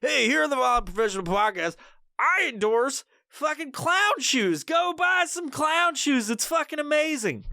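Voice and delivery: overselling a product in a goofy high voice; mock endorsement.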